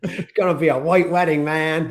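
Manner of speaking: hint of a snarling rock singer; lightly put-on rocker snarl; mock punk-rock sneer. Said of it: "That was in your [name] phase".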